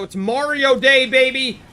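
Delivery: big, loud, high hype yell; excited cheer. Real, not acted. Streamer changing the topic to Mario, excited cheer. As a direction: stretch it out high and loud, hyping the day.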